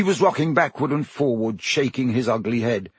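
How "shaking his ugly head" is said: plain reading voice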